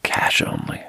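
terse voiceless whisper; whispered refusal. Retold or imitated. Old man refusing a credit card, terse whisper. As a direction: whisper it as the old man's reply.

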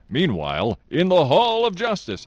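booming, high-swooping announcer voice; cartoon narrator. Podcaster quoting a superhero cartoon's narrator, booming announcer voice. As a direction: belt it out like a superhero show narrator. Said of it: "Who does that one?".

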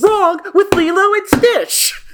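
squeaky, indignant wail; high, outraged defence; high-pitched outrage. Real, not acted. Outraged defence of Lilo and Stitch.

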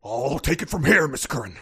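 impression of an impression of Nixon; Richard Nixon impression. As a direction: loosen the jowls and do Nixon. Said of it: "do you have a Richard Nixon impression".

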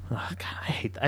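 hushed, breathy aside; disgusted whisper. Real, not acted. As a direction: mutter it under your breath in distaste.

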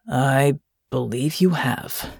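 slow, low, pensive captain; low and thoughtful. A narrator's reading. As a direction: say it slowly, deep in thought. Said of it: "his voice low and thoughtful".

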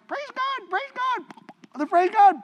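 high squeaky little fishies chirping praise; piping fish singing out praise; tiny fish voice. Pretend. Preacher voicing the little fishies, squeaky and high.

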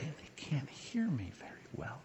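hushed breathy whisper, barely audible. Shown as a demonstration. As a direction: drop to a secretive whisper so no one hears. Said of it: "I'll just speak so quietly".

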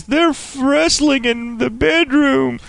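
put-on high, slow innocent kid; squeaky kid tattling; wholesome 1950s kid. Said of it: "Kid goes running".

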